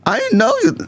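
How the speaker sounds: high-pitched mock old Southern lady.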